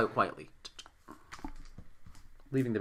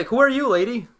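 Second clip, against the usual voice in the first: raised, high-pitched call to a stranger; loud demand; 11 semitones higher, 11 dB louder, slower than usual. Acted. Player confronting the veiled woman across the room, loud demand.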